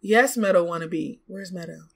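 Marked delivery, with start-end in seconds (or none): none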